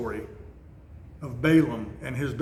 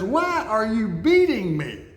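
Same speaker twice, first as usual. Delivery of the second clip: much higher, hurt and pleading; pleading talking donkey; 6 semitones higher than usual. Retold or imitated. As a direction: voice the wronged donkey, high and begging. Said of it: "and the donkey says".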